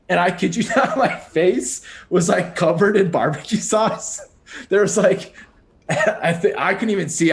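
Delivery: laughing while telling. Real, not acted. Recounting barbecue sauce covering her face, laughing. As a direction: laugh your way through the punchline of the story.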